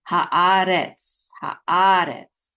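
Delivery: Haaretz with a long open ah, stress on the middle; Hebrew sounding out. Shown as a demonstration. Teacher sounding out Haaretz for the class. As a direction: say Haaretz slowly and clearly. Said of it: "This syllable says Retz".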